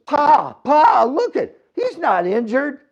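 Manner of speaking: put-on high, loud boy's cry of alarm; a boy yelling to his father that the man isn't hurt; excited shout. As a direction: shout to Pa that he's faking.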